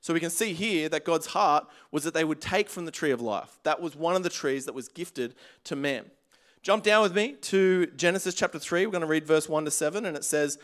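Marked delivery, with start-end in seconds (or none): none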